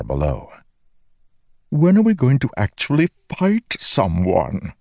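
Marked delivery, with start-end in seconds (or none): 1.7-4.7 eager, high-pitched talking sword. Acted. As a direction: play an impatient living sword. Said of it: "asked the sword on his back".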